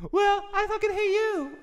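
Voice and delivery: very high falsetto hate; hammed-up falsetto quarreler; bickering partner. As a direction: echo it back in a squeaky put-on voice. Acting both sides, falsetto partner.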